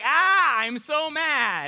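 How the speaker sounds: loud, drawn-out cry of rage; angry yell. Shown as a demonstration. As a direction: demonstrate an over-the-top angry holler. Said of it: "It's more like".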